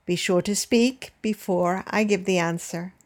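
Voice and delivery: ordinary delivery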